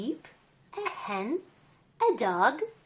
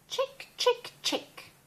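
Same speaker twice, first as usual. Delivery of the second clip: chick cheeps; 4 dB quieter than usual. Acted. Chick sound in picture book.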